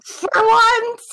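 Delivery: high delighted cry; excited cheer. Real, not acted. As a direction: cheer like luck finally came. Cheering rare good roll.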